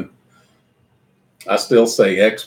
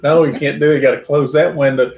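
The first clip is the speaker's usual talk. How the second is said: retold yelling; 5 semitones higher, 5 dB louder than usual. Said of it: "yelling at the screen".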